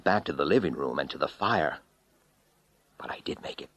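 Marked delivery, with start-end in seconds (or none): 3.0-3.7 grim whisper. A narrator's reading. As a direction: whisper it, spent.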